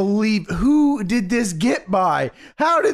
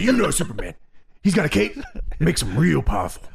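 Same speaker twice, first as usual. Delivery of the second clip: lower, sing-song Hogan impression; Hogan's cadence; 8 semitones lower, much faster than usual. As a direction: friend imitates how Hogan phrased the Superman line. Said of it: "talk about the cadence that Hogan says this in".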